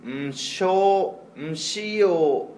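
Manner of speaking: slow halting syllable-by-syllable reading; sounding out words. Retold or imitated. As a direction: read like a child stumbling over each syllable. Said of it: "みたいな".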